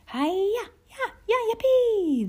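very high, joyous whoops; yelling. A narrator's reading. Laura and Lena urging ponies on, yelling. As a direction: whoop out a long yippee. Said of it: "they yelled".

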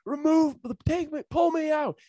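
very high, loud mock screech; imitated hysterical customer yelling back; defiant shrieking. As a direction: dare them at the top of your pitch. Retold customer screaming a defiant dare.

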